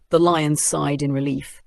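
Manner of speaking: plain reading voice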